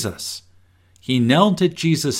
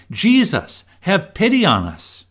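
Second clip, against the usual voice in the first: raised, higher begging plea; pleading cry; 8 semitones higher than usual. A narrator's reading. Lepers crying out to Jesus, pleading. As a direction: beg Jesus for pity. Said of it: "They cried".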